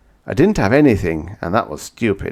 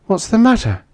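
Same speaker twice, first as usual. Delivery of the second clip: higher, startled woman's voice; frightened; 6 dB louder than usual. Acted. Frightened woman asks the narrator what is wrong. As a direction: ask in sudden alarm.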